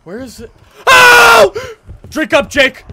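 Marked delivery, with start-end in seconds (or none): 0.9-1.8 loud, very high yelp of fright; startled scream. Real, not acted. Gamer jumped by Granny, startled scream. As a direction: scream out at a sudden scare. Jump scare.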